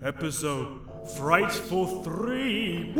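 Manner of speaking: very slow, booming villain welcome; dark lord. Acted. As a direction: drawl it out like a horror host. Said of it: "we're acting like children".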